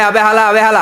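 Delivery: put-on Old Dhaka slang. Preacher mimics Old Dhaka locals' constant slang, loud and hammed up. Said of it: "খালি কয়".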